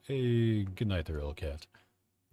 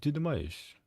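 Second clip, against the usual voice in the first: higher, switched-over Russian voice; Russian speaking voice; 7 semitones higher, slower than usual. Shown as a demonstration. Streamer asking chat in her Russian voice. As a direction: slip into your Russian voice for the question. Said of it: "my voice changes when I speak Russian".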